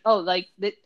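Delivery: ordinary talk, nothing marked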